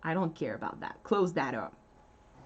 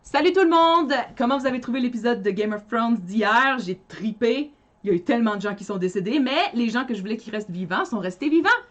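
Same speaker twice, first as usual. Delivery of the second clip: lively French show-opening delivery; livestream intro; 3 semitones higher, faster than usual. Shown as a demonstration. Host opening the live in French, lively intro. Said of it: "the intro to the live in French".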